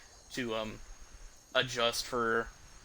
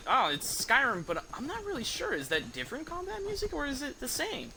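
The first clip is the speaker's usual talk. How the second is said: high-pitched puzzled listener voice; imitating unsure listener; 12 semitones higher than usual. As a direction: mimic someone wondering aloud, pitched way up. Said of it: "You can, you're like".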